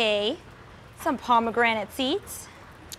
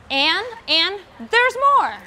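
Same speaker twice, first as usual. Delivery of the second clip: higher, louder, slow build of excitement; excited; 8 semitones higher, 5 dB louder, slower than usual. Real, not acted. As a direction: tease the extra topping. Adding more toppings.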